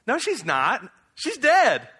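high and loud, scoffing disbelief; incredulous protest. Acted. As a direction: protest that she is plainly dead. Crowd scoffs at Jesus' claim.

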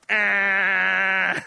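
long, loud, sustained noise; exasperated mock yell. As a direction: drown out the questions with a held yell. Mimics reaction to endless why questions.

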